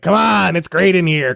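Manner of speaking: mock demonic taunt. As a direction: lure them in, hammed-up. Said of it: "face is appearing... going like".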